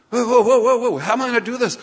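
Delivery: loud, high burst of mock alarm; alarmed protest. Disciple balking at the works given by Jesus, alarmed. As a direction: halt them with high, loud, overwhelmed whoas.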